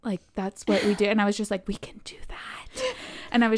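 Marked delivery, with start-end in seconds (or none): 1.7-2.9 slow, hushed disbelief; awed whisper. Retold or imitated. Novice amazed they can buy the collection, awed whisper. Retelling awed reaction to purchase.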